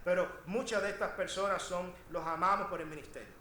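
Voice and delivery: normal talking voice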